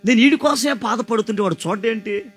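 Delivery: loud, high, fervent preaching to the crowd; full-force preaching. Acted. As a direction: preach it out, building and calling hallelujah. (బిగ్గరగా, ఉత్సాహంగా ప్రసంగం)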